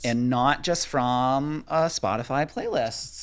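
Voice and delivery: slow Minnesota accent. Shown as a demonstration. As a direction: keep the Minnesota vowels going. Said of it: "Shout out for that accent".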